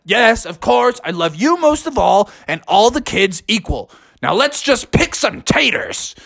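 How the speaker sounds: lightly voiced dad smoothing things over; placating husband. A narrator's reading. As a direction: placate the wife, then rally the family.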